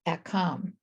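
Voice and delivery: usual speaking voice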